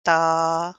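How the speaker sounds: ता stretched one beat. Shown as a demonstration. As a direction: hold ता as long as बा. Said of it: "जितना बा को किया था".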